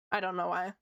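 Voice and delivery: talking while chewing gum; words around a wad of gum. Real, not acted. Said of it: "I'm just chewing my gum".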